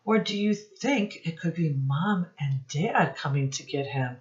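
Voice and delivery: ordinary delivery, nothing marked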